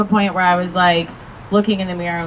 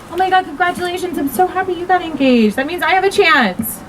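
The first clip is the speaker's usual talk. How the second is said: high, quick gushing voice; mock a gushy congratulator, pitched way up; impression of someone she hates; 9 semitones higher than usual. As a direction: squealy fake congratulations. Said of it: "do some impressions of people I hate".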